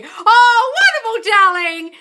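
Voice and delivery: loud, high, sing-out thanks; gushing delight. Real, not acted. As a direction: gush over a fan's praise. Gushes at a viewer's compliment.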